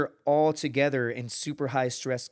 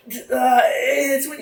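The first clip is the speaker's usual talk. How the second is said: flustered blurt; 12 semitones higher, 10 dB louder, much slower than usual. Retold or imitated. Recruit stumbling when quizzed.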